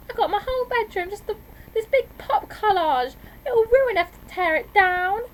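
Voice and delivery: high, loud, drawn-out complaint; dismayed little sister wail; whining protest. A narrator's reading. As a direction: whine about the ruined bedroom. Sister protests about her bedroom.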